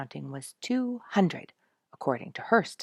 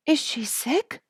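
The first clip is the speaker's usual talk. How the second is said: concerned; 12 semitones higher, 9 dB louder than usual. A narrator's reading. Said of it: "with immediate concern".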